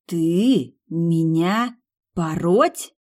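plain reading voice

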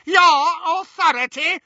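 very high, loud scornful echo; incredulous sneer, rising question. A narrator's reading. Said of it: "she sneered".

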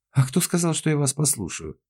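high, loud indignant challenge; affronted retort. A narrator's reading. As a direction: protest with pitch leaping up. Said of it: "возмутилась она".

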